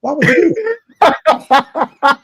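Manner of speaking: high, loud laughing protest; high, loud, laughing why would you; laughing outrage. Real, not acted. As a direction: burst out laughing in disbelief.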